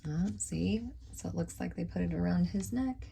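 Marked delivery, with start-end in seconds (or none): none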